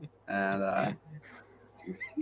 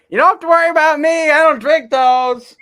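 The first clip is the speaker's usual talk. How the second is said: mock Ryan voice; 11 dB louder than usual. Friend mocking Ryan turning down the free drink, high loud falsetto. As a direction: ham up Ryan's cluelessness, high and loud. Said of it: "and then Ryan goes".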